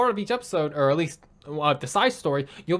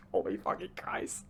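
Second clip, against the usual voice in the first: breathless whisper; 14 dB quieter, slower than usual. Real, not acted. Overwhelmed by the ending.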